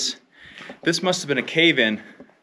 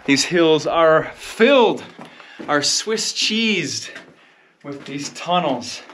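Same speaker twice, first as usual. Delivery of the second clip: slow and awed; 3 semitones higher, slower than usual. Real, not acted. Marvelling at the landscape.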